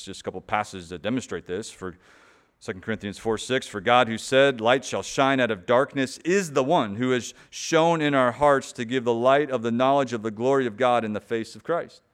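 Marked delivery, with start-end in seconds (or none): 3.7-12.0 loud, ringing scripture reading to the congregation; forceful preaching. Acted. Pastor proclaims Hebrews to his church, loud and ringing. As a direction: preach the verses out full-voiced, driving each line.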